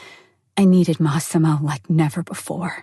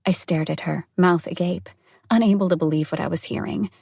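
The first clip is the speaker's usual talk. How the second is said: ordinary delivery, nothing marked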